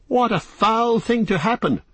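high-pitched, distraught outcry; wild cry. A narrator's reading. Frodo despairing over his trapped friends, wild high cry. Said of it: "cried Frodo wildly".

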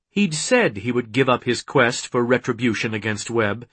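plain reading voice

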